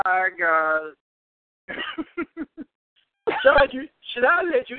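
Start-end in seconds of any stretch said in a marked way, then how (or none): 1.7-2.6 surprised laugh. Real, not acted. Host laughs at caller's surprise.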